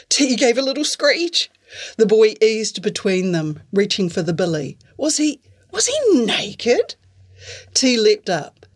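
ordinary delivery

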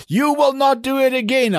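high-pitched imitation of a caller laying down the law; mock telling-off, pitched well up; retold stern forbidding. Retold phone call forbidding her.

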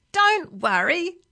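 calling out. A narrator's reading. Driver reassures his alarmed passengers, calling out. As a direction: call it back over the noise. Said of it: "called Billy Castor".

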